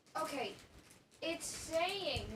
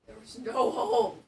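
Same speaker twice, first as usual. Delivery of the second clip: louder and loud on hole, falling at the end; frustrated shout; 13 dB louder than usual. Real, not acted. Venting at the furniture piece, loud exasperated shout.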